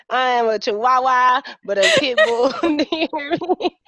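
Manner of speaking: a small dog yapping big; playful boast. Real, not acted. Jokes she is fierce.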